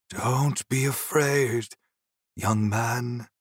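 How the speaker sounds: put-on slow, reassuring old man's voice. Old ghost calming the young man, old man voice. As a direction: speak slowly as a kindly old ghost. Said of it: "He had the voice of an ordinary old man".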